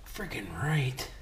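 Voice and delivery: whispered catchphrase. Real, not acted. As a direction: say it under your breath.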